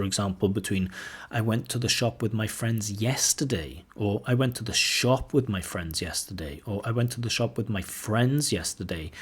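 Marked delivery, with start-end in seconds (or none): 1.3-3.8 "yesterday" carries the sentence stress. Shown as a demonstration. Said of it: "the word that you want to stress". 4.3-6.6 "shop" carries the sentence stress. Shown as a demonstration. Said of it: "the word that you want to stress". 6.8-9.1 "friends" carries the sentence stress. Shown as a demonstration. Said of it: "the word that you want to stress".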